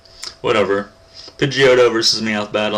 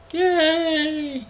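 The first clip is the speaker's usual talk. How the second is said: high falsetto "yay"; mock cheer. Gamer capping a silly tuna joke, mock falsetto cheer.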